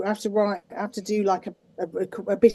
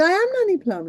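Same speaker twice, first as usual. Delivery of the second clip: Nanny Plum voice; 11 semitones higher, 4 dB louder than usual. Retold or imitated. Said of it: "And I said".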